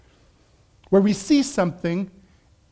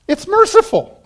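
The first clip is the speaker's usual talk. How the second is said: high, loud exclaim of the punchline; excited burst; 11 semitones higher, 8 dB louder than usual. Real, not acted. Preacher to congregation, high excited exclaim. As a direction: throw out the line in a high laughing burst.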